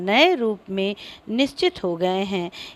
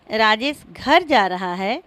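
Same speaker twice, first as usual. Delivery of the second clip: "घर" punched out after Rajesh; stress on घर. Shown as a demonstration. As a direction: put the weight of the sentence on "घर". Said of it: "पदों पर बल देकर बारी बारी से". ("घर" पर बलाघात)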